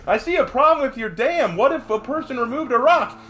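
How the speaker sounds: high-pitched cartoon wise guy; Bugs Bunny. Rabbit pointing out a problem with the dam, high cartoon voice. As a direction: needle the villain in a high cartoon voice. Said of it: "Bugs walks down".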